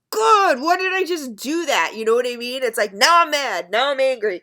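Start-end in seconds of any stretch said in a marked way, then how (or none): 0.1-1.9 high, loud groan of self-reproach; exasperated at herself. Retold or imitated. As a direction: kick yourself out loud for a silly choice. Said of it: "I go".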